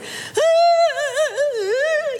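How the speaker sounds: high crying wail, hammed-up; madwoman wail. Wife wailing in the kitchen over a blender, mock madwoman cry. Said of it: "like the woman you hide in the attic".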